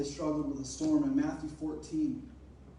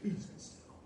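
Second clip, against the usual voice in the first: hushed whisper, softer; Jesus hushing the storm in a whisper; whispered command; 3 semitones higher, 6 dB quieter than usual. Retold or imitated. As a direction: breathe the words to calm the storm. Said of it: "He's like".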